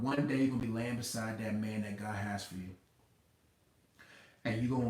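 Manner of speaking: normal talking voice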